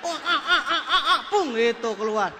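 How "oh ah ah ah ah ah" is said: loud, high rhythmic "ah" grunts; mock straining grunts. Speaker imitating patient pushing the bottle out, mock straining grunts.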